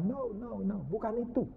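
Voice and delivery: ordinary delivery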